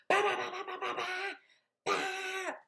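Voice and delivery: high honking bah sounds; imitated car horn. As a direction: honk like an angry car horn.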